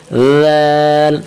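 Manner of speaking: زال drawn out long and soft; slow soft زال for pupils; soft zaal demo. Shown as a demonstration. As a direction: hold زال softly like a teacher's model. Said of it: "تو آواز نکالیں زال کی".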